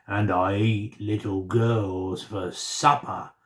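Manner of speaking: slow menacing monster; roared. A narrator's reading. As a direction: slowly menace the little girl. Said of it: "roared the monster".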